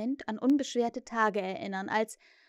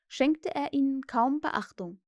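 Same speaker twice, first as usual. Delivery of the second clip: plain reading voice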